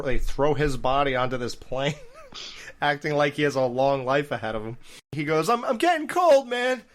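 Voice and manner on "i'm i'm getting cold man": high-pitched mock plea; imitating dying man. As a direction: act out a wounded man's complaint in a much higher voice. Acting dying Roach's movie line.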